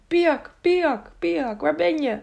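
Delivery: high, drawn-out searching call. A narrator's reading. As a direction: call for a missing friend, pitched well up, without real shouting. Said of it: "en riep".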